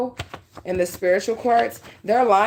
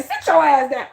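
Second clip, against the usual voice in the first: higher-pitched, playful telling-off; mock scolding; 6 semitones higher, 4 dB louder than usual. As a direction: pretend to order someone to sit, only joking. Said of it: "No, I'm just playing".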